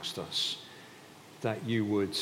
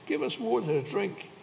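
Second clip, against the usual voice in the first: high-pitched mock complaint; quarrelsome demand; 12 semitones higher than usual. Thirsty Israelites quarrel with Moses, high quarrelsome demand. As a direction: demand it like a cross crowd, much higher. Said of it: "So they quarreled with Moses".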